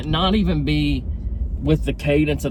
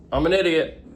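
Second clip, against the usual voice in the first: normal talking voice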